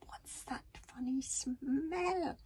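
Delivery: slow, high, hushed hiss; whispery, suspicious question. A narrator's reading. Tiffenstorm smells danger in the tunnel, hissing. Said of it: "hissed Tiffenstorm".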